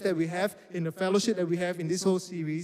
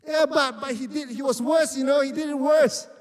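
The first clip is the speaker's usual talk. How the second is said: put-on much higher and louder, a childish protest; whiny protest; 7 semitones higher, 5 dB louder than usual. Answering God in prayer, protesting like a sulky child. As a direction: whine like a kid who insists it's unfair.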